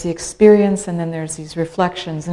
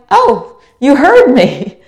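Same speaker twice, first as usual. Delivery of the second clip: touched surprise; 8 semitones higher, 9 dB louder, slower than usual. Retold or imitated. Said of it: "And I'm like".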